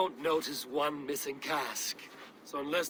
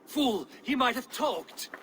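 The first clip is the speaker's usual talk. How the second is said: angry rebuke; 9 semitones higher, slower than usual. Acted. Rebuke after killing the scout.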